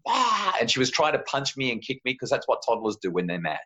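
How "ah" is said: high, brief mock scream; high-pitched "ah" tantrum cry; imitated toddler scream. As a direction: mimic a cranky child's yell. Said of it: "she just kept on screaming".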